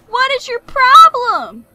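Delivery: loud, high, drawn-out holler; exasperated yell. Pretend. Repeats it louder at game enemy.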